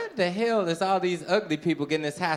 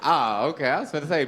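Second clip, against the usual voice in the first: ordinary talk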